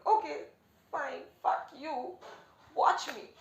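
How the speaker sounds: re-enacted furious challenge; defiant outburst. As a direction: throw it down like a dare, slow and loud. Retelling her defiant reaction to brother.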